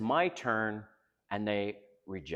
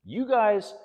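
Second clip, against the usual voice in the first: "You guys" raised sharply for emphasis; emphatic; 11 semitones higher, 8 dB louder than usual. Real, not acted. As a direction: hit YOU GUYS high and loud. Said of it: "I need to emphasize this".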